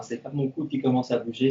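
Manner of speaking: ordinary delivery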